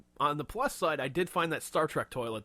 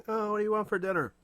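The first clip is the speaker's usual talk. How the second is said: high, louder put-on voice; spoof questioner; 9 semitones higher, 5 dB louder than usual. Asking Batman about dinner, high mock politeness. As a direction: ask it in a pitched-up singsong.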